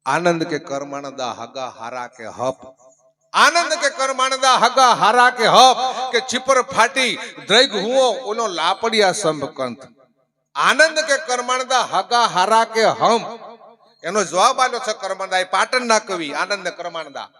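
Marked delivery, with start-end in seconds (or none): 0.0-2.6 slow, low verse recital; couplet recitation. Acted. 3.3-5.8 high, loud declaimed verse; full-voiced couplet recitation. 6.2-13.4 loud, slow verse recital; declaimed couplet. Acted.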